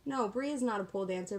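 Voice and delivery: ordinary delivery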